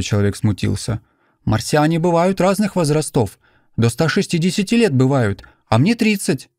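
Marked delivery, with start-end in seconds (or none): 1.4-6.5 put-on high, earnest young stranger; eager young man, a little embarrassed. A narrator's reading. Martian character voice.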